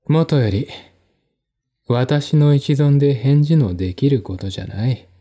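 ordinary delivery

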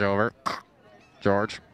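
ordinary talk

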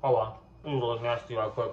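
talking around candy; mouth full of candy. Real, not acted. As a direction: lips pursed while chewing. Seen 4: Lips pursed, cheeks full while talking; then reaches for paper towel.

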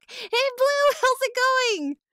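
high, loud sing-out hello; big bubbly welcome; excited greeting. Real, not acted. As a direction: greet a friend at a high pitch. Greeting a viewer joining chat.

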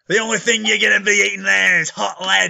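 hammed-up Australian shout; Dingo Drake. Shown as a demonstration. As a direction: belt it out as a brash Aussie hero. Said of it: "can you say that in Dingo Drake's voice?".

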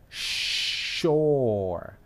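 SHORE with a very long, drawn-out O; O larga. Shown as a demonstration. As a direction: stretch the vowel in "shore", slowly. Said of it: "Es una O larga". ("shore" con la O muy alargada)

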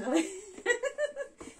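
high, helpless real laugh. Real, not acted. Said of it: "ça me fait rire". (rire aigu et franc)

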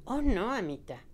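a high, loud horrified cry; shocked, frightened protest. A narrator's reading. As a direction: recoil in horror as you say it. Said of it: "Pork retrocedió horrorizado".

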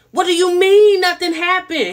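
high, loud, outraged question; shocked disbelief. Retold or imitated. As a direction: hit it with stunned outrage. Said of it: "Alexis says".

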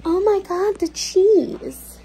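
high, amazed cry; excited gush. Real, not acted. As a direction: gush over the cheese. Marvelling at the cheese.

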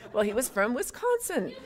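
says it with a laugh in the voice; laughing reply. Retold or imitated. Answering the professor with a laugh, amused.

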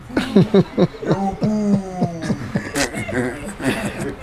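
a real, drawn-out laugh; long laugh. Real, not acted. Guest laughing at his own joke to the host, long real laugh. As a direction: let the laugh roll on for a while.

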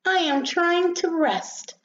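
high, slow, put-out little voice; exasperated complaint. Acted. Rosebud wants rest from visitors, exasperated.

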